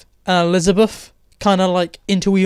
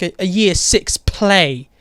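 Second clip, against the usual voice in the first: ordinary talk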